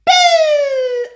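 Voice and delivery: high shouted boo; big playful scare shout; loud boo. Acted. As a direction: jump out and yell boo. Cub scares walrus.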